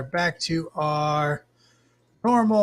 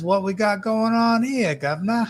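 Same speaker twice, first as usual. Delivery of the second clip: higher-pitched mock British accent; 7 semitones higher than usual. Streamer reading a viewer's chat comment aloud, mock British accent. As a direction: put on a hammy British lilt. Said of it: "why that sounded British in my head".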